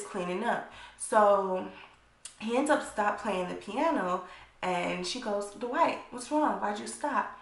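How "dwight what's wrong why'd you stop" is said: lightly voiced, higher worried question; worried Claudia. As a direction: ask him gently why he stopped. Claudia asks worried, acted quote.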